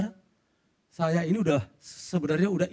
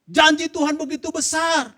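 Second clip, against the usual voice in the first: loud, high preacher's cry; rousing sermon proclamation; preaching; 10 semitones higher, 5 dB louder than usual. Acted. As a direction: proclaim it to the whole church. Preaching testimony to congregation.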